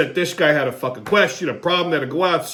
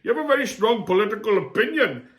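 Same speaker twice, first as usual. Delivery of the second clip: put-on Indian accent; 5 semitones higher than usual. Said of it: "he's looking at my shirt".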